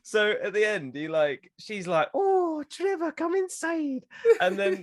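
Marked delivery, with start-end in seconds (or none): 2.1-4.0 very high, slow inviting voice; Italian lady. Acted. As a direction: put on a high Italian lady calling him in. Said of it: "the Italian lady who runs".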